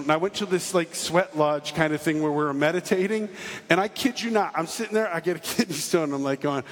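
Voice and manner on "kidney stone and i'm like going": normal talking voice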